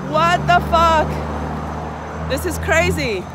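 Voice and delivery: loud, high-pitched exclamations of disbelief; exasperated outburst. Real, not acted. Dancer stranded without gas venting to viewers, loud exasperation. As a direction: raise your voice, high and exasperated.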